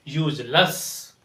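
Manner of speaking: slow "यूजलेस" as "yooj-luss"; correct pronunciation. Shown as a demonstration. Teacher repeating the model, "यूजलेस" as "yooj-luss". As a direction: schwa "luss" ending "यूजलेस". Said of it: "इसे यूजलेस पढ़ते हैं".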